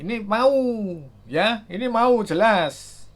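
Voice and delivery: drawn-out thrill as the number climbs; excited. Real, not acted. Tech reviewer to viewers as the charger kicks in, excited. Charger speed jumps on screen.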